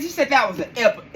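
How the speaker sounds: ordinary talk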